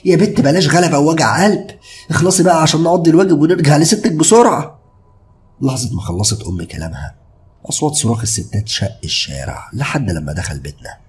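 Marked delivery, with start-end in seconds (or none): 0.0-4.8 exasperated, much higher and louder; annoyed mother. Acted. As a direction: scold the stubborn daughter impatiently. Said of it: "وهي بتتنهد بضيق". (ضيق وزهق الأم)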